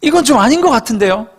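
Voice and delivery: high, fed-up Gyeongsang plea; mock-annoyed Gyeongsang grumble; Gyeongsang dialect. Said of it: "경상도 말로".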